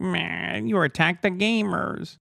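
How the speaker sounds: soft, drawn-out mock whine; mock complaining. Streamer imitating people who complain about gamers, mock complaining. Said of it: "people who fucking complain".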